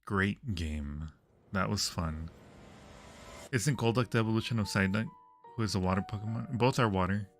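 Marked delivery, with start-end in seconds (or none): none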